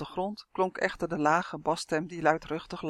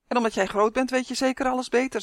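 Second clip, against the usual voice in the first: cheeky retort; 6 semitones higher, 5 dB louder, faster than usual. A narrator's reading. Boy talks back to giant.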